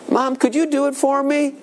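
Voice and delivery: put-on high childish plea; imitating a kid asking Mom; pleading child. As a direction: whine to Mom.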